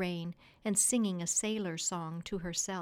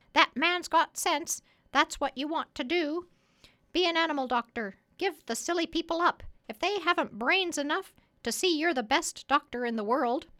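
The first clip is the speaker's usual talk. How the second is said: high, loud parrot voice; bossy parrot; 8 semitones higher, 6 dB louder than usual. Acted. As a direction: urge the doctor on in a high, insistent parrot voice.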